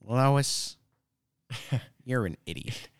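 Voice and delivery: lightly voiced Peter Griffin, laughing through it; half-hearted cartoon dad voice. Friend copying the Peter Griffin line back, half-laughing. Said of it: "Peter Griffin".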